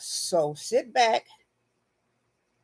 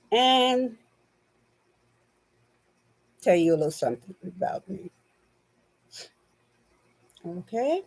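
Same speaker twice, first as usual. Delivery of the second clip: nasal spray congestion voice; just used nasal spray, a bit stuffed; after nasal spray. Real, not acted. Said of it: "I took some nasal spray".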